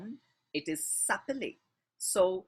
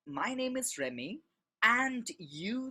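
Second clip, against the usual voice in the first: ordinary delivery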